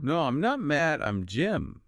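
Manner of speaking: high-pitched denial with NOT strong; stress on NOT. Shown as a demonstration. Learner echoing the teacher, stress on NOT. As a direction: lift the line and stress "not". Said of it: "Emphasize not".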